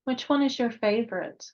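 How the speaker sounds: measured meditation voice with long pauses; guided meditation. Real, not acted. Guide leading listeners to meet their spirit guides, calm meditative delivery. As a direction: guide listeners gently inward, leaving space between phrases.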